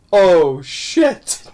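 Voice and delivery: a dismayed Oh, shit in a put-on voice; quoting the film's dismayed curse in a character voice; imitating Mrs Doubtfire's dismay. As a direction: mimic his dismay as the mask is crushed. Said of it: "and he's like".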